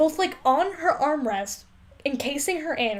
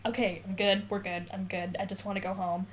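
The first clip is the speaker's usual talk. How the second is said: weak sick voice; 5 semitones lower, 9 dB quieter than usual. Retold or imitated. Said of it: "he's like".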